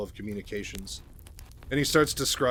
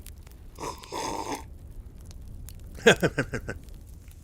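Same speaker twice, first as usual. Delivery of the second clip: real laugh; 3 semitones higher than usual. Real, not acted. Narrator amused by their own Raynor voice, laughing. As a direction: crack up at your own impression.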